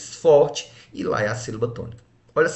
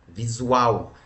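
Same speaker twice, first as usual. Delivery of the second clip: "visual" with the final syllable drawn out and strong; stress on last syllable. Shown as a demonstration. Teacher repeating the word so pupils hear it, final-syllable stress on "visual". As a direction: let the end of "visual" stand out. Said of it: "a sílaba forte é a última".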